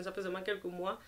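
normal talking voice

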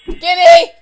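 very loud, high demanding yell. Real, not acted. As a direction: yell it into the microphone. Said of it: "I'm yelling into my microphone".

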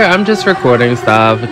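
usual speaking voice

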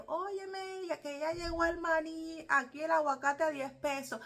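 imitating a Cuban street vendor calling out; street vendor's call. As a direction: sell peanuts and avocado to the street. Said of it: "al que está vendiendo en la calle".

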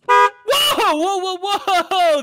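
loud, startled string of whoas; big whoa, then tumbling repeats; excited outburst. Acted. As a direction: burst out in excited alarm. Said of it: "I got a little bit excited".

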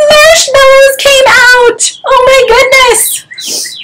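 very high, slow squeal; hammed-up storyteller shock, words drawn out; astonished reveal. A narrator's reading. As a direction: squeal it slowly in mock amazement.

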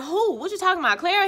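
high, feigned surprise; mock surprised innocence. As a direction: play dumb, voice way up.

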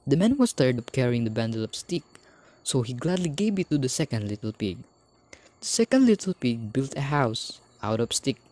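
ordinary delivery

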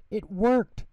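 B one thrilled his hiccups are gone; gleeful shout. Acted. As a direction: cheer it out with glee. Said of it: "shouted B one with glee".

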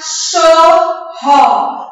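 "cachorro" loud and slow, ca... chor... ro; slow teacher's split of "cachorro" into syllables. Shown as a demonstration. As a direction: break "cachorro" into three strong beats. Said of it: "vamos separar as sílabas".

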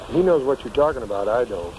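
ordinary delivery

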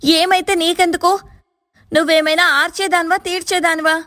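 high, snapping voice near tears; angry and tearful. A narrator's reading. Sister-in-law snapping at her brother's wife, angry and tearful. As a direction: lash out through tears. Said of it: "కోపం మరియు ఏడుపు గొంతుతో అడిగింది".

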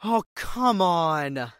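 loud, stretched-out exasperated yell; frustrated outburst. Acted. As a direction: shout it at the stuck machine. Body stuck in compactor.

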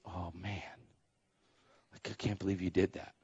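very low, hushed imitation of a dad; dismayed aside. Dad to son about praying for her back, hushed dismay. Dad's dismayed look, voiced.

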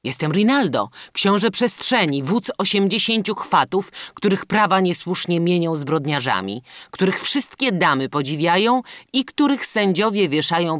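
loud, high self-introduction; boastful bandit. A narrator's reading. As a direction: proclaim your titles with bravado. Bandit boasts of his titles.

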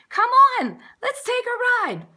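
high, excited invitation; big, high-pitched come-along; eager coaxing. A narrator's reading. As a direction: urge them along like a showman. Lion tamer coaxes snow dude.

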